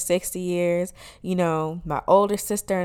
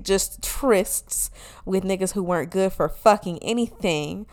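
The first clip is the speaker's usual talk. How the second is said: usual speaking voice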